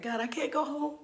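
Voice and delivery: high, whimpering dread; mock crying. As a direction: whimper like a scared kid, hammed up. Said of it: "Why is she crying?".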